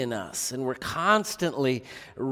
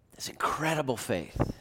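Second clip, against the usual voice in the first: a hushed, breathy whisper of "that's incredible faith"; whispered awe; 6 dB quieter than usual. Real, not acted. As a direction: breathe it out in wonder. Awed aside after quoting the thief.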